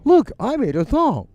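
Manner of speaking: high, slow, put-on boastful voice; mocking song thief. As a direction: sneer at the lazy thief with a proud squeaky voice. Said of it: "and be like".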